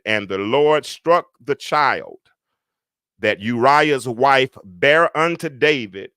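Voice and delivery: slow, drawn-out scripture reading; measured pulpit reading. Real, not acted. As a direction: read the verse out solemnly, word by word. Rereads the verse aloud.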